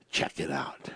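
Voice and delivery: breathy whispered invitation; whispered aside. Real, not acted. Preacher inviting listeners to read, whispered. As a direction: drop to a whisper.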